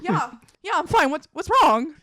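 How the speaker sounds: high, loud fake-cheery yeah I'm fine; hammed-up cheery denial at work; mock chipper denial. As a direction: brush it off way too brightly. Acting dazed denial at work.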